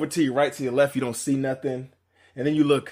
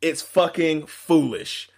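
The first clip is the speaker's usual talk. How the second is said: ordinary talk, nothing marked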